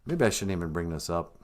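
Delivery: usual speaking voice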